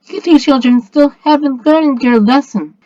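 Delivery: high-pitched, stern reproof; commanding. A narrator's reading. Spirit figure admonishes two villains, high commanding voice. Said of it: "commanded Feline".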